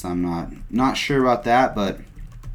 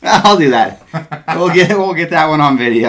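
higher, louder, laughing through it; 8 semitones higher, 10 dB louder than usual. Real, not acted. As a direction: laugh while you say it. Laughing at the Chad joke.

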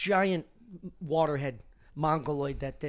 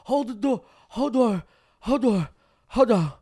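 louder, higher, words melting each other; slurring into hey door; 6 semitones higher, 5 dB louder than usual. Retold or imitated. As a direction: hold the door slurring into Hodor. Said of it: "he keeps saying".

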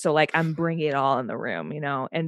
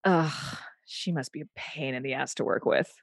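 muttered disdain; 3 semitones lower, 6 dB quieter than usual. Retold or imitated. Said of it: "he said".

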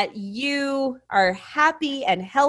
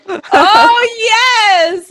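excited yes; 9 semitones higher, 8 dB louder than usual. Real, not acted. Eager agreement, loud, high.

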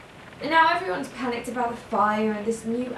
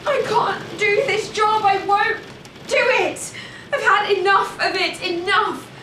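angry refusal; 6 semitones higher, 3 dB louder than usual. Acted. Telling her sister she is fed up with the job, angry.

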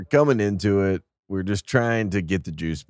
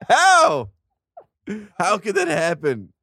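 squeaky, much higher stunned "how"; rising higher and louder in disbelief; shocked disbelief; 12 semitones higher, 4 dB louder, slower than usual. Retold or imitated. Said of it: "I'm like".

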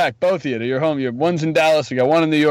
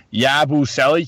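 slow, drawn-out Boston accent on "Yabusele"; slower than usual. Shown as a demonstration. Cohost dares him to say the name in a Boston accent, slow Boston accent. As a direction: say "Yabusele" the Boston way, stretched out slowly. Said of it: "try saying it in the Boston accent".

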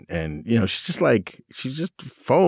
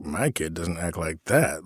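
smug superior; 4 semitones lower, 6 dB quieter than usual. Retold or imitated. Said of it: "feeling, like, superior".

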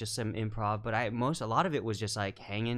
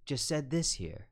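gentle coaxing director; 6 semitones higher than usual. Retold or imitated. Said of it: "You just give suggestions".

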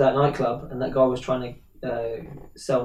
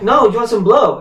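louder, higher insistent repeat; insistent dealer; 8 semitones higher, 6 dB louder than usual. Retold or imitated. Dealer repeats his offer to Nick, insistent and louder. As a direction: push the offer again, louder. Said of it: "The guy's like".

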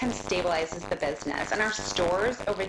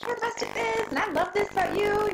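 high, bright hype for her family; mock cheerleader pep, pitched way up; cheerleading; 11 semitones higher than usual. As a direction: gush like a cheerleader, much higher. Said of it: "I'm a loud cheerleader".

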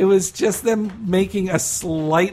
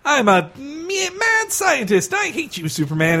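cartoon mad scientist; 8 semitones higher than usual. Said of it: "'cause before he was just".

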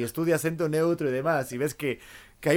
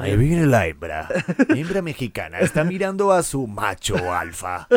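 Spanish documentary dub; 3 dB louder than usual. Acted. Comedian narrates women like wildlife, Spanish documentary dub. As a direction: narrate in a mock Castilian dubbing voice. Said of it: "hacer más como español".

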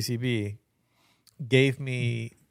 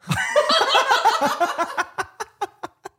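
loud high laugh; 6 dB louder than usual. Real, not acted. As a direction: burst of high-pitched laughter. Punchline of strip club story.

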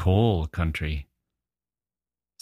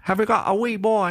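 put-on much higher, childlike voice asking about a wee boy; little Scottish girl. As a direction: imitate a small girl's piping question. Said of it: "little girl came up".

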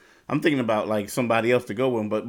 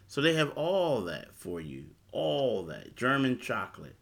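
ordinary delivery

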